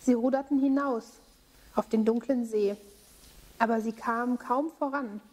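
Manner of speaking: calm storytelling voice; story narration for children. A narrator's reading. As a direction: tell the tale to kids gently. Bible story told to children.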